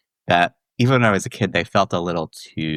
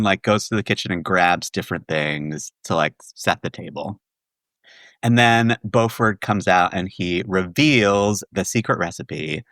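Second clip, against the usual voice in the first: usual speaking voice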